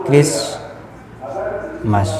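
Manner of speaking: "क्रिसमस" as kris... mas, long pause between; "क्रिसमस" in two separate pieces; syllable by syllable. Shown as a demonstration. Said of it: "और ये क्रिसमस".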